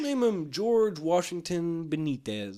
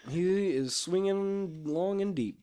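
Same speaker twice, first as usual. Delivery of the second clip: booming announcer; 5 semitones higher than usual. Pretend. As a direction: stretch it like a home run call. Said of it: "announcer at a baseball game".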